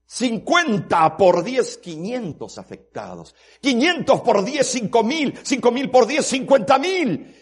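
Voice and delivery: loud, high shout over music, slow; fiery sermon climax with music; preaching at full force. Acted. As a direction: shout the numbers climbing to Satan. Preacher multiplies sin, shouting.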